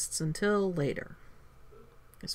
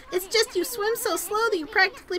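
higher, boastful sidekick voice; companion character; 10 semitones higher, faster than usual. Acted. Companion boasts about not fearing the water dragon, higher voiced. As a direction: brag like a proud little companion.

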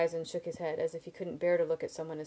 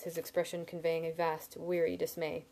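ordinary delivery

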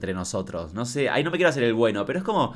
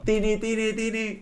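high singsong; 10 semitones higher, slower than usual. Pretend. Teasing singsong to a baby.

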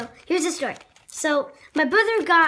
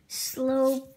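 ordinary talk, nothing marked

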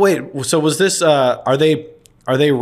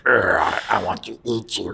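lower, softer monster voice; low creepy demon voice; 5 semitones lower, 5 dB quieter than usual. Retold or imitated. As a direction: growl it like a demon, quietly. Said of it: "He's like".

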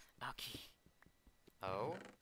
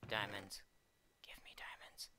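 hushed, whispered plea; 2 semitones lower, 5 dB quieter than usual. Pretend. Player to the game after wanting diamonds, whispering. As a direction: whisper it like a secret wish. Wishing for diamonds while mining.

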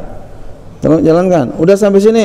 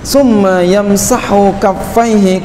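kitab recitation; 6 semitones higher, slower than usual. Acted. Reads Arabic kitab text.

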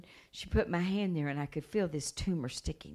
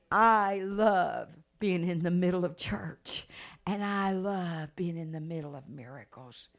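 emphatic preaching; 4 semitones higher, 6 dB louder than usual. Real, not acted. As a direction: proclaim it to the congregation.

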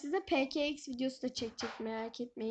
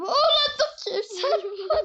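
startled yelp; 9 semitones higher, 6 dB louder than usual. Real, not acted. Startled, Granny coming.

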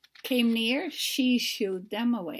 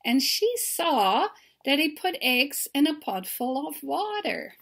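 ordinary delivery, nothing marked

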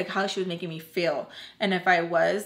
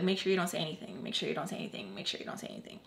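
repeated nagging fading to a whisper; fading nag; 3 semitones lower, 8 dB quieter, much faster than usual. Retold or imitated. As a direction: let each repeat fade softer until whispered.

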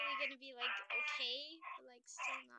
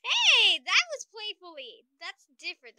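loud defensive hey; indignant protest; 4 semitones higher, 17 dB louder than usual. Real, not acted. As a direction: protest loudly.

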